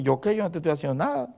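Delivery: soft, offended shrug of a denial; innocent Satan. Retold or imitated. Satan denying he did anything, soft mock innocence. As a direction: play the wrongly blamed devil lightly.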